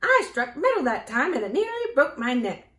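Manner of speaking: high, loud squawking complaint; pained outcry. A narrator's reading. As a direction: complain at full voice after a knock. Hurt hen cries out to Dorothy.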